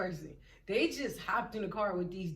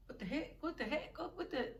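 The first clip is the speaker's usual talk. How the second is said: small, quiet, higher-pitched disbelief; stunned muttering; 5 semitones higher, 8 dB quieter, faster than usual. Retold or imitated. Left standing there as they drive away, stunned muttering. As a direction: mutter it under your breath, baffled.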